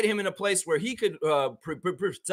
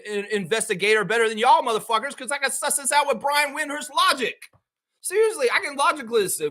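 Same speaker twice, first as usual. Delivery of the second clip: heated, higher-pitched argument; indignant rant; 6 semitones higher than usual. Real, not acted. Fan taking apart the media's cover story, indignant. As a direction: push the rebuttal up high, exasperated.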